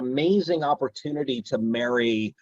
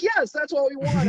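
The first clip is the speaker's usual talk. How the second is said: producers' mock insistence. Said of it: "And they're like".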